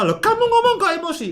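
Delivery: high, fast, annoyed retort; irritated demo. Shown as a demonstration. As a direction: snap back at an upset partner. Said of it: "Jangan malah". (balasan ketus, tinggi dan cepat)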